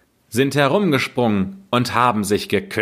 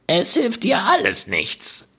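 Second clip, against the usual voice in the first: much higher, sneering witch voice; mocking witch; 11 semitones higher than usual. Witch scoffing at the crying Gretel, high and sneering. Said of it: "sagte die Alte".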